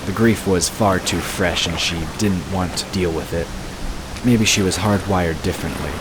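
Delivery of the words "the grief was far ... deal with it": ordinary talk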